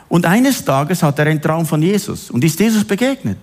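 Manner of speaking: ordinary delivery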